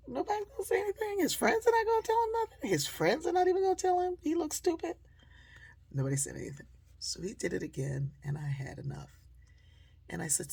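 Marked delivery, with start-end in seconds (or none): 0.1-5.0 high, fast, incredulous outburst; exasperated disbelief. Retold or imitated. Woman watching the van stay silent, high, fast and incredulous. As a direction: throw up your hands at his silent friends. Her silent outrage at friends.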